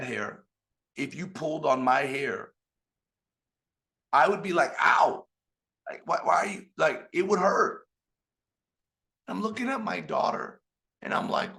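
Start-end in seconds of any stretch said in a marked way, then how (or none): 4.8-6.7 loud, sudden mock yelp of pain; acted pained protest. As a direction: protest as if your hair were yanked. Acts out reacting to pulled hair.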